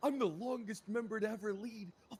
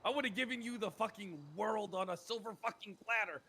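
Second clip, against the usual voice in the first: ordinary talk, nothing marked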